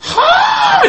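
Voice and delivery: 甲高く叫ぶ「はぁー？」; 大声で長く伸ばして聞き返す; 呆れた驚き. Retold or imitated. 呆れて聞き返す再現. (素っ頓狂な驚きの叫び)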